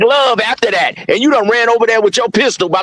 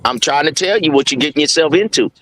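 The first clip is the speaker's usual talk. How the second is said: lightly voiced older man, pitched down; 6 semitones lower than usual. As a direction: imitate an older man's low warning to a youngster. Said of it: "that video of that older man".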